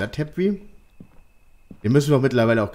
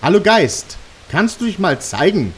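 high, loud call to the ghost; yelling across the room; 7 semitones higher, 5 dB louder than usual. Real, not acted. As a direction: shout it into the empty room. (laut in den Raum rufen)

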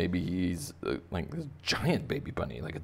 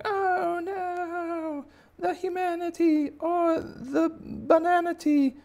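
very high, drawn-out mock wail; panicked crowd; 4 dB louder, much slower than usual. People running from the giant bunny, shrill mock panic. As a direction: wail slowly in hammed-up terror. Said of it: "all the people are running around going".

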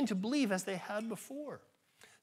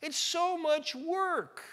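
mock whine; 9 semitones higher, much slower than usual. Mocking whiny complaint.

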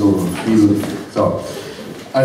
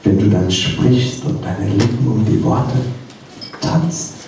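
hesitant love poem; 4 dB quieter, slower than usual. Acted. Poet at his desk addressing her, slow hesitant recital. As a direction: recite slowly and haltingly, letting it trail away.

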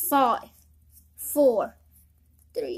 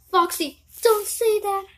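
ordinary delivery

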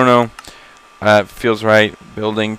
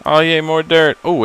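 ordinary delivery

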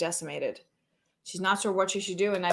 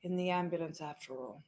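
soft, halting and forlorn, nearly fading away; Princess Diana; 10 dB quieter than usual. Acted. A lost princess musing alone on the apocalypse, hushed and forlorn. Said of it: "So that's Princess Diana".

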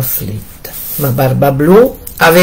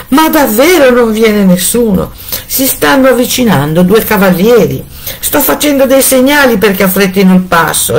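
anxious exchange; 6 semitones higher than usual. A narrator's reading. Wife and sister at tower.

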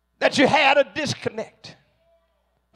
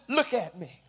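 hushed plea; 7 semitones lower, 11 dB quieter than usual. Acted. David pleading with the Lord.

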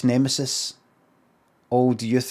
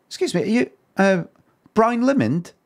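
put-on a high, half-hearted stab at an English accent; failed English accent on a stranger's question; attempted English accent; 8 semitones higher than usual. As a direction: raise the pitch and try an English accent. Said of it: "I wanna do an accent".

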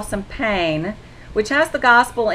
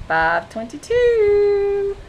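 delighted; 11 semitones higher, much slower than usual. Real, not acted. Spotting the number on the clock.

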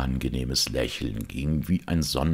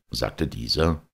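plain reading voice

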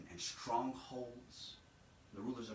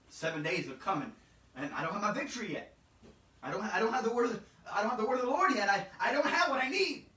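builds from a raised voice to a shout, much higher and louder; preaching at full force; 10 semitones higher, 11 dB louder than usual. Real, not acted. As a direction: climb to a fever pitch. Sermon climbs to full force.

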